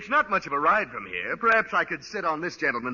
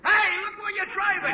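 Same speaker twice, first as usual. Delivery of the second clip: yell at driver. Acted. Pedestrian nearly run over.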